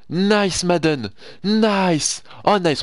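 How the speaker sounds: high, bright-pitched praise; delighted cheer. Real, not acted. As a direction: savour the replay. Praising the game's replay.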